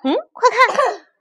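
high, loud, drawn-out exclamation; excited discovery. Acted. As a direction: call out to point at something. Zuofu excitedly spots a fly.

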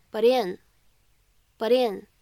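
"but in" run together with a tapped T; linked flap T. Shown as a demonstration. Teacher showing students linked words, flapped T joining "but in". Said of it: "还会形成一个flap T".

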